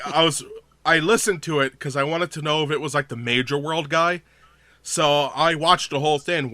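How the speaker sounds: ordinary talk, nothing marked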